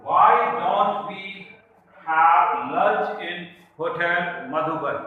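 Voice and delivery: slow dictation pace; slow example reading. Shown as a demonstration. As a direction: read the example very slowly and clearly. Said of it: "There is a sentence".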